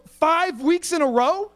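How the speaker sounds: high, drawn-out mock disbelief; pissed off. Student VP doubts the excuse for missed meetings, mock pissed off. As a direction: imitate an exasperated vice president, slowly. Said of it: "my V P was getting pissed".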